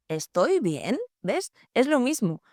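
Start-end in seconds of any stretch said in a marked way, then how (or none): 0.1-1.0 "¿Estoy bien?" con tono que sube al final; pregunta. Shown as a demonstration. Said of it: "solo cambia la entonación". (entonación interrogativa ascendente)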